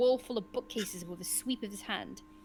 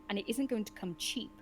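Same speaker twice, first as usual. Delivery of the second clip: ordinary talk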